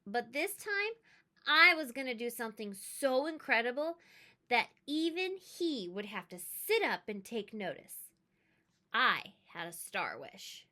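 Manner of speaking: ordinary delivery, nothing marked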